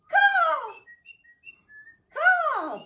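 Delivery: very high, loud cawing crow cry. Acted. Teacher cawing like a bird in bird pose. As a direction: screech it like a crow. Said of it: "Bird sounds".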